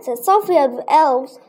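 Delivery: plain reading voice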